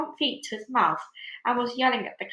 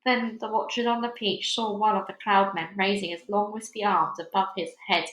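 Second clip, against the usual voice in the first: usual speaking voice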